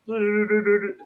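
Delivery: high little du-du-du echoed back in fun; mimicked pixie humming. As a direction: copy the pixie's trill right back at him.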